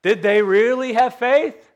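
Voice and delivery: high, loud challenge to the room; challenging question. Acted. Preacher challenging the congregation, loud pointed question. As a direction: put it to them hard.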